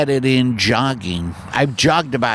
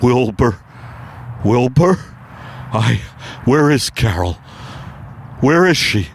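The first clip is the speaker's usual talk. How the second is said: a dazed horse struggling to breathe; Mister Ed on acid. Acted. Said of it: "my impersonation of Mister Ed".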